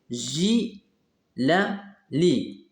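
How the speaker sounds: "J, la, li" as three separate beats, ji, la, li; split into syllables. Shown as a demonstration. Teacher finding the syllable with G, syllable by syllable. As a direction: sound out "J, la, li" with a pause after each piece. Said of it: "Entoure la syllabe où tu vois G".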